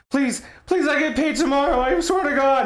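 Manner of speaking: high, pleading, panicked voice; pleading victim. Pretend. Robbery victim begging the intruder, high frantic pleading. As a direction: beg for your life in a squeaky voice. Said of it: "And he's like".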